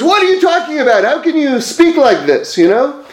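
upset retort. Retold or imitated. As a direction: act out a mildly upset wagon driver. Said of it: "gets so upset".

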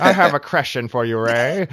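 hammed-up old radio host; old-timey radio voice. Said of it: "old-timey, fifties radio".

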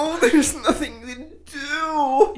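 high, slow, fake-crying whine with a laugh; mock Jacob's moaning in a whiny, high, sobbing voice.

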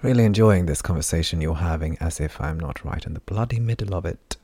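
usual speaking voice

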